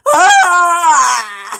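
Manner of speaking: loud cramping yell, retelling the pain; pained cry. Retold or imitated. Retelling the saddle cramp to her chat, loud pained cry. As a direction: cry out as the leg seizes. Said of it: "I'm going".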